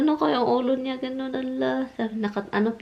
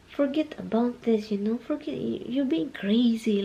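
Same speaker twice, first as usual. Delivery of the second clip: put-on like talking to a child; 3 semitones higher, 4 dB quieter than usual. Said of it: "parang bata ba?".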